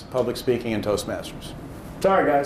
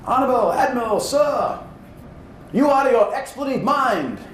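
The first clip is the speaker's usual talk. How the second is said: a pilot talking back in a tiny, raised voice; the little voice from the back of the TV, pitched high; 7 semitones higher than usual. Retold or imitated. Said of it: "this little tiny voice go".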